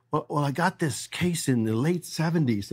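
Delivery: a hint of a hesitant old customer; nervous old guy. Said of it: "He goes".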